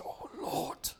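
whispered, aching plea; a whispered groan to God from a troubled heart. Acted. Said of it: "that groaning in his heart".

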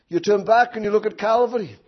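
normal talking voice

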